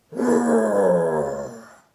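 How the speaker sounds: deep, loud roar; bear roar. A narrator's reading. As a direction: let out a big low bear roar. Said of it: "and it came out".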